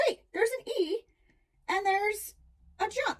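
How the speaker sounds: put-on high, wondering kid voice with pauses; surprised student. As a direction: act out a child's sudden discovery.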